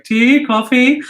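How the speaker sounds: high, loud mock attendant offer; imitating the attendant. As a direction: chirp the offer like a train attendant. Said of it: "and she was like".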